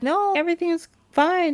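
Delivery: lightly voiced nervous girl; high girl voice. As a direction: brush it off up high. Vasilia lying to witch.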